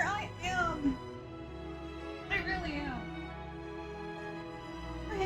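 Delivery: normal talking voice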